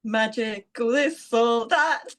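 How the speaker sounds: giggling through the jingle line; sing-song it while laughing. Acted.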